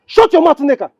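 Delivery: high, sharp silencing order; angry command. Acted. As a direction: cut your wife off angrily. Husband silences wife in quarrel.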